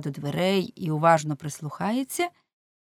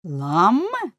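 "Ламме" with a big upward swoop, louder; echo question; 4 semitones higher, 6 dB louder than usual. A narrator's reading. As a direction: query "Ламме" back, pitch swinging high. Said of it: "перепитав Улленшпігель". (перепитування: «Ламме» з різким підйомом тону)

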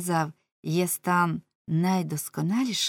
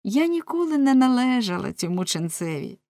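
raised, insistent denial falling away; emphatic protest; 6 semitones higher, 4 dB louder than usual. A narrator's reading. Wife denying she was the monk's, protesting. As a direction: protest your innocence with feeling.

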